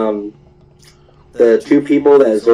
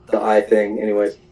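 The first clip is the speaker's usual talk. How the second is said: ordinary talk, nothing marked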